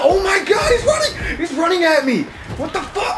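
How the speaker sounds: high laughing panic screams; panicked shouting. Real, not acted. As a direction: shriek and laugh as it charges. Monster charges at player.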